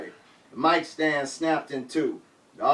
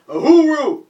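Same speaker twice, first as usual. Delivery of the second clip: high, loud call; hollered; 11 semitones higher, 10 dB louder than usual. Retold or imitated. Friends in b-boy stances hollering support, loud call. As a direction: shout it as a rallying cry. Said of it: "and hollered".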